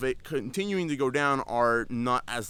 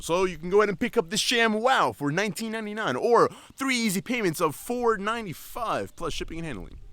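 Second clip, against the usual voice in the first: higher, louder TV pitchman; infomercial voice; 9 semitones higher, 5 dB louder than usual. Pretend. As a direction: sell it like a late-night ad. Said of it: "I kinda sound like an infomercial".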